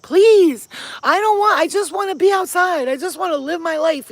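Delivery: high, loud imitated begging; pleading cat. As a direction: beg as a trapped, distressed cat. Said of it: "She was like".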